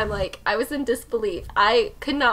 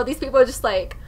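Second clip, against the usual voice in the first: ordinary talk, nothing marked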